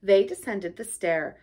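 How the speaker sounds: ordinary delivery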